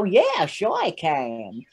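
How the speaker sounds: very slow American drawl; exaggerated cowboy-style American accent; put-on American accent. Shown as a demonstration. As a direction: stretch it out in a hammed-up American twang. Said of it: "Can you do an American accent?".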